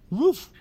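playful high bark; mock woof. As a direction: bark at Jeannie for a laugh.

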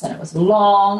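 usual speaking voice

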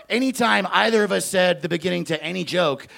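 ordinary delivery

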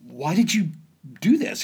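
rising disbelieving question, pitched high; incredulous. Retold or imitated. Dad baffled by the son's heavy pack, incredulous.

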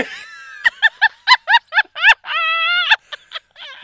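loud high laughing fit; hysterical laughter. Real, not acted. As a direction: laugh until you cry. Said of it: "I'm crying".

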